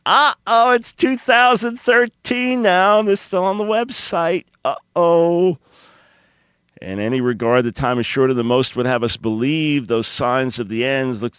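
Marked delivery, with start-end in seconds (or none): 0.1-5.6 sarcastic high uh-oh; mock alarm. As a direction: play fake alarm, high and loud. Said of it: "I am being sarcastic".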